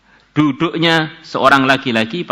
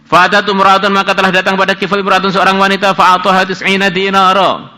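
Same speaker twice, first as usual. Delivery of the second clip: higher, louder chanted Arabic reading; hadith recitation; 7 semitones higher, 6 dB louder than usual. Acted. Teacher reads the Arabic hadith aloud, chanted. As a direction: recite the hadith text formally.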